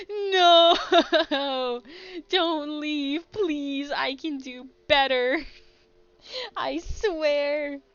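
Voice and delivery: desperate mock plea. Streamer's character pleads with viewers not to leave, loud hammed-up begging. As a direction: beg like a heartbroken fool, hammed up.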